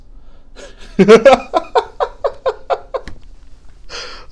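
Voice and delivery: long, very high real laugh; uncontrolled laughter. Real, not acted. Podcaster amused by his disco champion bit, high helpless laughter. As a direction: burst out laughing at your own bit. Cracks up at own impression.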